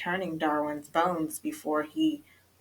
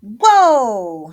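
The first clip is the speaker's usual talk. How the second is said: loud, high, falling cry of amazement; awed whoa; 4 semitones higher, 12 dB louder than usual. A narrator's reading. Skipito discovers a jungle in his closet, amazed. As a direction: let out a big, stretched whoa.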